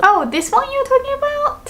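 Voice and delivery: high playacted "oh" of confusion; mistaken realization. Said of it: "that person thought".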